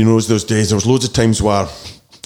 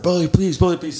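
imitated crowd cheer; 8 semitones higher than usual. Crowd cheering the new nickname, high mock chant. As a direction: mimic a crowd chanting a name. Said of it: "the crowd went nuts and started cheering that".

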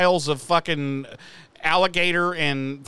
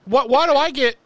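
indignant; 6 semitones higher, 5 dB louder than usual. Retold or imitated.

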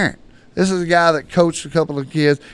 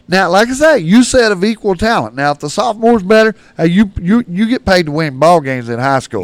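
heated; 4 semitones higher, 6 dB louder than usual. Real, not acted. Heated point to the listener.

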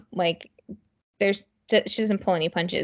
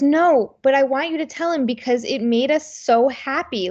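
raised, louder insisting mom; 6 semitones higher, 4 dB louder than usual. Retold or imitated. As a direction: push back eagerly like mom would. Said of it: "And she goes".

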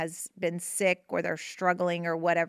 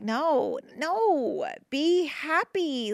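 higher and slowly stretched, coaxing; gentle insistence; 7 semitones higher, much slower than usual. Retold or imitated. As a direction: coax them gently, drawing out be happy. Answering the guilty griever.

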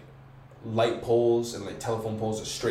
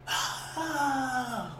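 mock scream; 4 dB quieter than usual. Imitating a distant scream.

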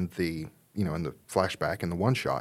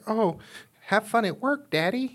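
put-on high little child's voice; sweet high kid saying goodbye; 13 semitones higher than usual. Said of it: "they go through and be like".

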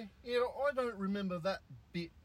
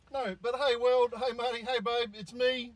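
hammed-up intro impression; 7 semitones higher, 8 dB louder than usual. Riffing on the intro track to his co-host, hammed-up impression. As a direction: play the track's voices, loud and raised.

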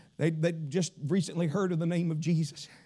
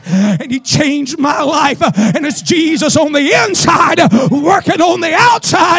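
preaching build to shout; 8 semitones higher, 18 dB louder, slower than usual. Real, not acted. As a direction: start firm, climb to a high full-force shout.

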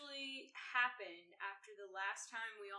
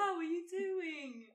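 singsong voice; 8 semitones higher, 6 dB louder than usual. Pretend. Continuing singsong host voice.

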